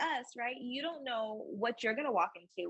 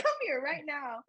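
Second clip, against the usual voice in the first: raised-pitch mock command to a child; scolding mom; 7 semitones higher than usual. Mom calling her defiant two-year-old over, scolding. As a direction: act out a mother ordering her toddler over.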